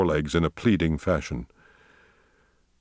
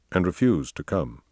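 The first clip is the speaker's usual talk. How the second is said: plain reading voice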